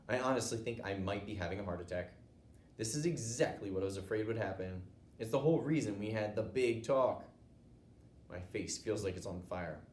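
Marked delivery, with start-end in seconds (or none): none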